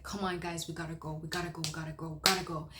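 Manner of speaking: ordinary talk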